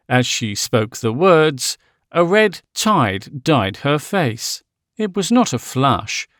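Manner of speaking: plain reading voice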